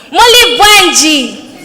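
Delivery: Muli Bwanji called loud and slow to the crowd; calling out greeting. Real, not acted. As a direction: call out Muli Bwanji for a reply. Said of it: "you will respond to me by saying".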